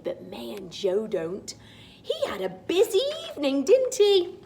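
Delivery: usual speaking voice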